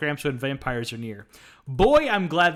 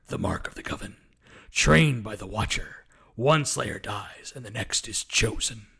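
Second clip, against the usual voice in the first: low, slow dramatic voice-over; solemn movie intro voice; movie trailer narration; 4 semitones lower, slower than usual. Pretend. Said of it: "do more of an Anthony Stewart Head accent".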